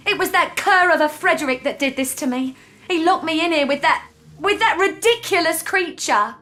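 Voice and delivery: indignant. Acted. Freed captive blaming Frederick for locking her in, indignant. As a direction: vent outrage at the one responsible.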